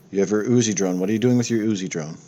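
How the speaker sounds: ordinary talk, nothing marked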